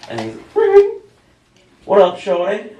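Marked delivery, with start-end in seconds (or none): 0.5-0.9 very high, loud ringing noise; high-pitched mock ringtone; imitated phone ring. 1.8-2.7 higher, louder slangy greeting; lightly voiced dad trying to sound hip; a hint of mock hip-hop swagger; dad acting street. Dad's acted slangy phone greeting.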